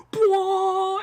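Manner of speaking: high, sustained "bwah" blare; imitated organ blast. Fan showing co-host how the score sounds, imitated organ blast. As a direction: sing out one big organ note. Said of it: "It's this huge organ".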